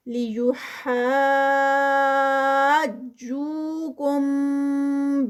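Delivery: slow drawn-out tajweed recitation, vowels held; Quran recitation. Shown as a demonstration. Tajweed teacher reciting a verse for students, slow melodic recitation. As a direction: chant each word with its lengthening. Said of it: "یہ six counts پر".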